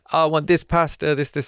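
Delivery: gleeful fake Italian accent; mock Italian accent. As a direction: order pasta like a comic Italian diner. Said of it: "I can't do Italian accent".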